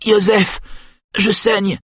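mild mock cry of pain. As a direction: she fakes a wound to lure her brother, pitched high. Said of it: "crie-t-elle".

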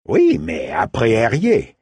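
a hint of a ship's captain calling back; lightly voiced captain. Captain answers her on deck.

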